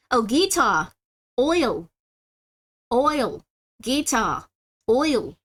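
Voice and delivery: practising Australian "guitar" and "Oil"; playful put-on Australian; Australian accent. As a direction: try an Australian "guitar" with the final r dropped. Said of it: "Oh, Australian".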